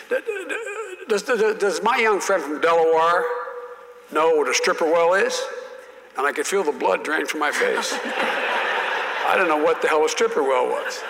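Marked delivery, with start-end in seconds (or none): 0.1-5.4 stuttering Southern drawl. Retold or imitated. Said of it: "he stood up, he said". 8.0-9.2 laugh. Real, not acted. Laughing at his own story.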